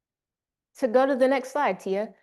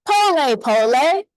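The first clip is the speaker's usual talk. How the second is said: with chest out; 5 semitones higher, 5 dB louder than usual. Shown as a demonstration. Speaker leads the audience in the motto, chest out. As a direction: belt it out proudly from the chest. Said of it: "say it with your chest out".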